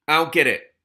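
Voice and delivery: loud baffled complaint; exasperated. Real, not acted. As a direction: complain it out to Sam. Calls to Sam, confused.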